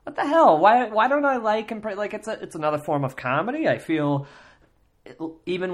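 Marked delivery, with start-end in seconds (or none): 0.0-3.7 high, loud incredulous outburst; mock disbelief. Retelling his own baffled reaction to the impressions, incredulous. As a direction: throw up your hands in mock bafflement.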